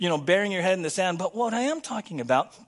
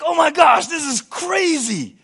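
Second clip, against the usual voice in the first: louder, higher, crazy stretched out slowly; giddy new believer; 8 semitones higher, 8 dB louder, slower than usual. Retold or imitated. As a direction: gush like someone just saved.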